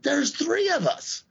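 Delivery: Stan upset. Retold or imitated. High-pitched upset protest. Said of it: "He's like".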